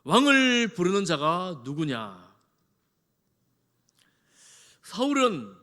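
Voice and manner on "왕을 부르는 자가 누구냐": loud, slow imperious demand; lordly, drawn-out shout; shouting as if king. Acted. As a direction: boom it out, stretching the opening word. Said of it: "자기가 왕인 듯 소리를 치고".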